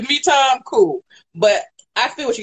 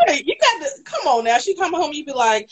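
ordinary talk

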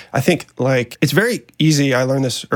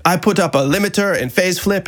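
higher-pitched mock French accent; terrible French accent; 5 semitones higher than usual. Musician imitating an online critic from France, terrible French accent. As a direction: do a badly faked French accent. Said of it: "I'm gonna do a terrible French accent".

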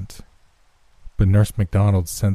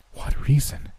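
breathless frightened whisper, pitched up; a frightened girl whispers the question; 7 semitones higher, 4 dB quieter than usual. A narrator's reading. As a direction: ask it in a scared hushed whisper. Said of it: "in a frightened voice".

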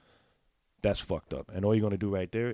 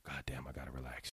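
muttered to self; 6 semitones lower, 13 dB quieter than usual. Retold or imitated. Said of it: "homie wasn't like".

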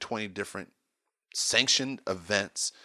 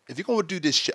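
attempted British accent; 8 semitones higher, 3 dB louder, faster than usual. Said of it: "I can't even do it".

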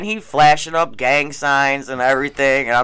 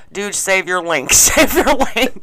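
high, slow, laughing through the words; giggling imitation of the guy; laughing quote; 4 semitones higher than usual. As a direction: crack up while quoting him.

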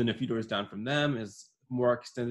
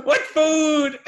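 high, loud yell through laughter; laughing yell; 10 dB louder than usual. Retold or imitated. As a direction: shout it again while cracking up. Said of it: "screaming down my neighborhood".

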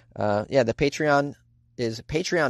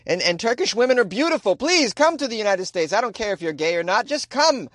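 high, emphatic begging invitation; playful over-the-top plea; mock pleading; 7 semitones higher than usual. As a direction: beg them to come, hammed-up. Mock plea to Turkish women.

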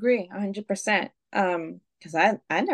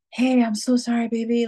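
sweet apologetic voice; 5 semitones higher, 5 dB louder than usual. Retold or imitated. Sweet apology to angry boyfriend.

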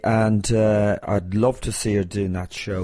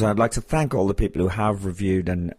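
ordinary delivery

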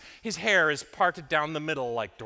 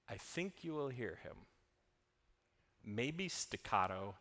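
soft sermon; 6 semitones lower, 12 dB quieter than usual. Acted. Quiet reflective sermon close.

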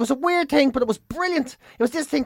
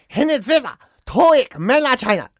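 loud caricatured Chinese English, pitched up; mock Chinese accent; 5 semitones higher, 4 dB louder than usual. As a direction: put on a broad fake Chinese accent. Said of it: "You gotta put on their accent".

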